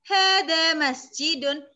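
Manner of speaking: hadza maktabun, each vowel drawn out; slow, loud teacher's model of hadza maktabun; slow vowelled reading. Shown as a demonstration. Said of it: "sambil diharokati". (hadza maktabun dibaca pelan dengan harakat)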